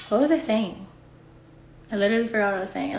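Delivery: ordinary talk, nothing marked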